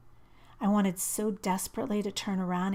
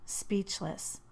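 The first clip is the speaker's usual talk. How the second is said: plain reading voice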